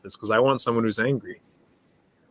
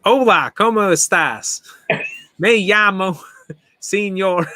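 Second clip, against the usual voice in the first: put-on Spanish said slow and higher in a joking Texas accent; 6 semitones higher, slower than usual. Football coach teaching Spanish in a joking Texas accent. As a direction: drawl the Spanish like a Texan coach, laughing. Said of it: "in a Texas accent just to joke around".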